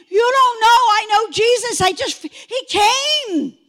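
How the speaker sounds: high, loud, overwrought testimony; excited insistence. Retold or imitated. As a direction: insist on it, crying out the last words.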